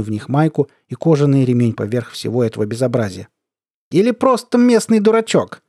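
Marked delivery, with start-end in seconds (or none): 3.9-5.6 high, loud jeer; mocking. A narrator's reading. Colonel sizing up the narrator, mocking. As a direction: needle him in a derisive high voice. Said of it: "сказал он насмешливо".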